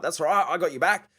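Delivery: brash big-spender bravado; big generous mate. Retold or imitated. Shouting mates out for a night.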